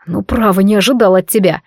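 ordinary delivery

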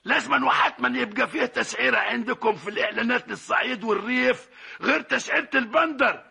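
ordinary talk

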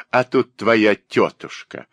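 plain reading voice

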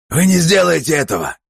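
very high, desperate shout; cried out. A narrator's reading. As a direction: shout it out in protest. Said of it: "выкрикнул Мартин Брэме". (отчаянный крик)

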